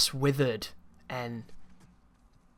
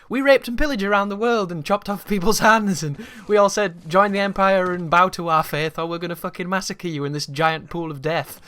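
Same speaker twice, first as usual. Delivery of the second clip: louder, higher mock-brutal proclamation; forceful retold ultimatum, raised voice; mock conqueror's threat; 4 semitones higher, 6 dB louder than usual. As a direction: declare it like a marauding empire, hammed up. Mock brutal version of empire history.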